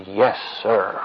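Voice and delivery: imitated child answering up properly; clear, audible reply. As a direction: say it firmly enough to be heard. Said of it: "I can't hear you".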